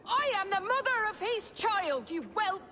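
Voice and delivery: mother screaming back at her insolent brother; furious outburst. Acted. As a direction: bite the truth out at him.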